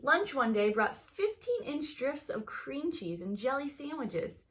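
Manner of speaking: lively read-aloud storytelling voice; story narration. A narrator's reading. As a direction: tell it like a bedtime story to kids. Teacher reads picture book aloud.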